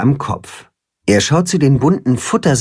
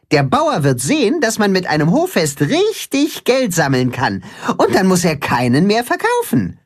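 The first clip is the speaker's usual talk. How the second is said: higher-pitched animated character voice; keen explaining; 6 semitones higher than usual. A narrator's reading. Katzenhuhn explains the plan to the animals, eager explaining. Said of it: "erklärt das Katzenhuhn".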